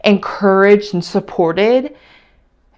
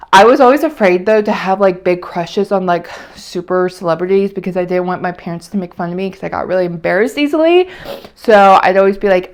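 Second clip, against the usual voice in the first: ordinary delivery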